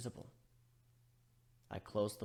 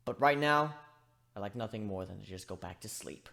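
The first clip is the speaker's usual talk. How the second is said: hurt and tired; 5 semitones higher, 12 dB louder than usual. Acted. Telling his mates he loves them but is hurt, weary. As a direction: let the anger drain into sadness.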